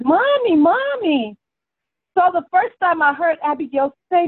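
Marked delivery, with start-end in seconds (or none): 0.0-1.4 put-on high, childlike cry of Mommy; imitating a child's scream. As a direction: copy a cartoon girl yelling for mom, pitched way up. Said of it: "And she screams".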